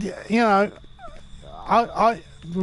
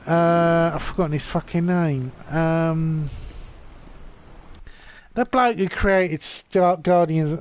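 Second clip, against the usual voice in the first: ordinary talk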